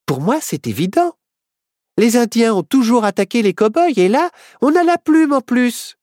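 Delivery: put-on high, loud girl sure of herself; insist, climbing very high; Myrtille's voice. A narrator's reading. Myrtille character voice.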